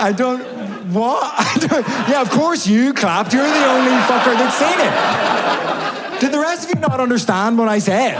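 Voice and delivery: high, loud incredulous scolding; worked-up, high-pitched indignation; exasperated rant. Acted. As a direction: berate the crowd in disbelief. Scolding crowd for not clapping.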